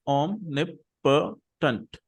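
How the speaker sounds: omnipotent drawn out very slowly, syllable by syllable. Shown as a demonstration. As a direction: spell the four syllables of omnipotent out slowly for the class. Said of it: "So there are four syllables".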